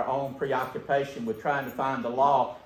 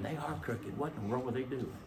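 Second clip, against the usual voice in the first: imagined listener's thought; 10 dB quieter than usual. Retold or imitated. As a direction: murmur it as a listener's private thought. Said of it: "some of you are sitting there thinking".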